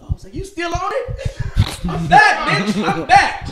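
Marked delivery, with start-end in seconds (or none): 0.3-1.9 very high, slow, disbelieving squeal; incredulous mock. Mocking the woman on the drug. 1.9-3.5 very high, loud, laughing cheer; giddy mock. Voicing the woman high on the drug.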